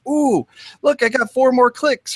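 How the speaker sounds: high-pitched pretend excitement; mock delight. Host imagining himself scoring email stats, mock excited. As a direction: ooh over a small win, playfully.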